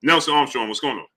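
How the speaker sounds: usual speaking voice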